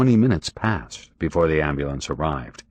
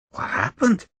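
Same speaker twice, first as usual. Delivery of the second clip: surprised question. A narrator's reading. Ambulance driver asks.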